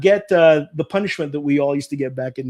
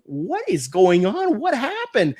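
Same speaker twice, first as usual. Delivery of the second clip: a higher, lightly voiced concerned aunt; 6 semitones higher, slower than usual. Aunt seeing her bleeding son, higher concerned voice. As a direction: ask it like a worried aunt, rising high at the end. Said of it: "very concerned voice".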